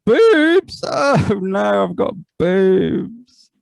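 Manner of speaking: high, slow hammed-up dismay; mock shock. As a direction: squeal it in pretend panic. Mock horror at new body.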